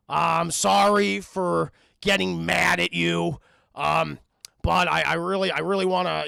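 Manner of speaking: loud, slow, stiff Beast; reluctant apology. Retold or imitated. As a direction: force out the apology haltingly.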